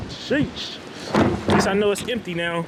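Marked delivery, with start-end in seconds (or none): 0.1-0.8 breathy high sheesh; whispered disbelief at the mess; exasperated sheesh. Real, not acted. Sees trash can knocked down.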